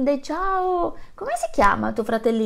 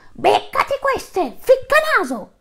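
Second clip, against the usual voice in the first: much higher, loud, slow yell; king's shout; 6 dB louder, slower than usual. Acted. Lion king yells at the nosy rhino, loud and high. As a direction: fling the insult at the rhino. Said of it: "così il re gridò".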